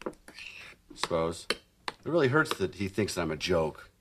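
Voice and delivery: low, soft, halting admission; subdued and wounded; quietly hurt. Acted. Father admits his hurt.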